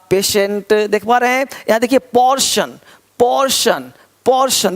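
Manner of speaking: normal talking voice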